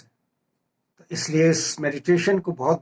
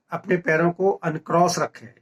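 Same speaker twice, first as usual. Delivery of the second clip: ordinary talk, nothing marked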